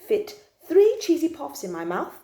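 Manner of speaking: usual speaking voice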